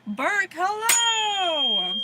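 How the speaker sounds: big slow sing-out to a new arrival; excited greeting. Real, not acted. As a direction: call out a greeting, stretching it long. Greeting a viewer joining the stream.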